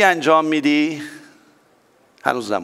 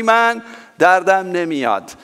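ordinary talk, nothing marked

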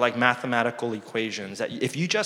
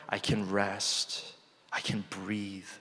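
calm and slowing; 4 semitones lower, 10 dB quieter, slower than usual. Acted. Psalm paraphrase, settling into rest.

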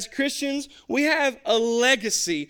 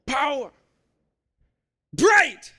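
louder and higher, building shouts; shouting; 5 semitones higher, 5 dB louder, much slower than usual. Retold or imitated. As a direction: fire it back, gaining confidence. Junior shouting back.

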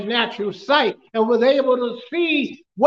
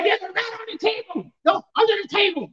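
alarmed cry; 6 semitones higher than usual. Retold or imitated. Mother-in-law alarmed by a rat.

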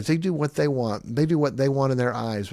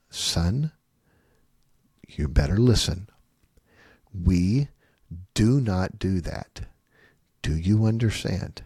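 low, deliberate words with long pauses; slow, controlled firmness; 5 semitones lower, slower than usual. Shown as a demonstration. Parent warning son not to do that, slow and firm. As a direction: warn without raising your voice, word by word. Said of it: "very slow and then very soft".

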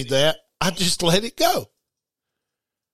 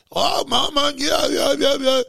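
imitating a thrilled daughter calling her dad; excited daughter on the phone; 6 semitones higher than usual. Father imitating his daughter's late-night call, excited high yelps. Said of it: "she called about one o'clock".

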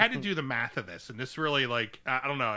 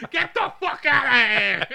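high, loud, drawn-out mock yell; angry shout; 10 semitones higher, 9 dB louder than usual. Gym guy throwing out a meddler, hammed-up angry shout. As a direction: bellow it to chase someone off.

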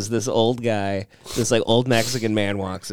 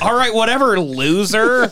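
dismissive; 10 semitones higher, 4 dB louder than usual. Retold or imitated. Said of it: "John's like".

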